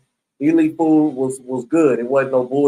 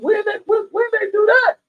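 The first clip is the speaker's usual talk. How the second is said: shocked disbelief. Retold or imitated. Waking up told he was electrocuted.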